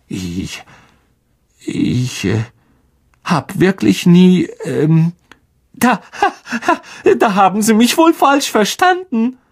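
high stammer with a nervous giggle; falter, giggle, then rush the excuse; panicked Lockhart. A narrator's reading. Lockhart wriggling out before his colleagues, nervous stammer.

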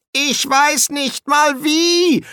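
high, drawn-out desperate cry; slow, high-pitched wail of helplessness; panicked yelling. A narrator's reading. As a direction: yell it back helplessly, way up high. Panicked reply to shouted order.

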